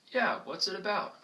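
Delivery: high, eager passerby asking; passerby reply. Retold or imitated. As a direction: play a curious stranger, pitched high. Role-play of passerby reply.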